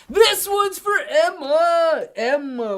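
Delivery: high, drawn-out hype shout; shouted dedication. Real, not acted. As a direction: call it out slow and loud like an announcer.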